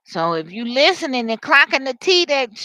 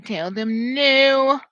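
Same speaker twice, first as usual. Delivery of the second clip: drawn-out refusal; 3 semitones higher, 5 dB louder, much slower than usual. Acted. Acted refusal, relayed from Spirit.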